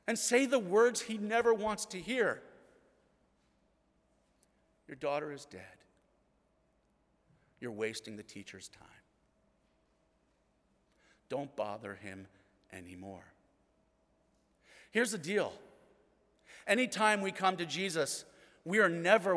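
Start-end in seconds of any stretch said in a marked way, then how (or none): 4.9-13.3 hushed bad news. Messengers bring news of death.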